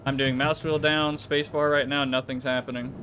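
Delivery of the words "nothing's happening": usual speaking voice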